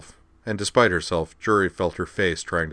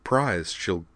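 stammering, repeated first sounds; nervous stutter, trying to be upbeat; 6 semitones higher than usual. Acted. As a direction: keep tripping over each word. Caitlin keeps stuttering.

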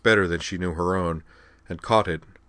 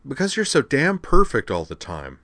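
loud, high, fed-up outburst; exasperated complaint to Juri; 8 semitones higher, 5 dB louder than usual. A narrator's reading. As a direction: snap it out in exasperation.

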